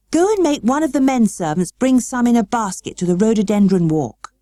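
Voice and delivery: lightly voiced boy, commanding; a hint of a bossy young master; Colin giving orders. A narrator's reading. Colin telling Mary to fetch the tea, lightly commanding. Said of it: "he said".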